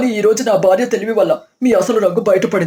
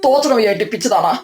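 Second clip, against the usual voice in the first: plain reading voice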